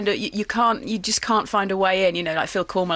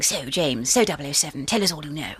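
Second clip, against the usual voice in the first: lightly husky, lispy Judi Dench; a hint of stern spymaster, lisping and husky; 5 semitones lower than usual. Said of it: "got this lispy ... husky thing".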